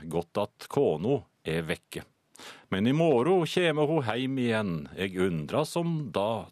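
measured, lilting west Norwegian dialect recital; Nynorsk dialect poem reading. Shown as a demonstration. Radio host attempts a listener's poem in dialect, slow Nynorsk recital. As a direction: recite rhymed verse in broad Nynorsk-style dialect, lines falling at the end. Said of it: "jeg kan prøve å lese den på, ja, den ligner jo litt på nynorsk".

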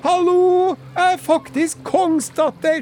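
furious dowager screeching from the quay; shrill queen yelling. Acted. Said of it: "hun ropte etter dem". (høy, skingrende dronningstemme som roper)

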